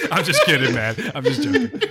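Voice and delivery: high, breaking up laughing; real laughter. Real, not acted. Host telling Kevin he is just kidding, laughing hard. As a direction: talk through a laughing fit.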